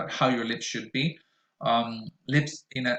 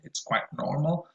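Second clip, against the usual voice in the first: ordinary talk, nothing marked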